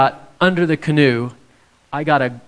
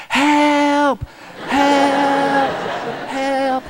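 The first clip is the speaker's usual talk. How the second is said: high-pitched mock cry of help, each word falling; wife crying for help; 13 semitones higher, much slower than usual. Husband imitating his wife calling for help from the tipped canoe, high and panicky. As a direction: squeak out the wife's panicked calls, pitched way up. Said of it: "And she goes".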